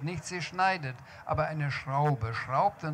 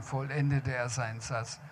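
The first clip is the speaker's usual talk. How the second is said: usual speaking voice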